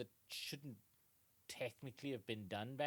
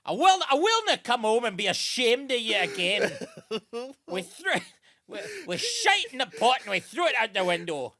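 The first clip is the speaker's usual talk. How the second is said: much higher and much louder, laughing through a ranting father; outraged Scottish dad; 9 semitones higher, 15 dB louder than usual. Retold or imitated. Dad scolding his son home from London, laughing loud rant. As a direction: berate the son like a furious old Scot. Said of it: "to his dad".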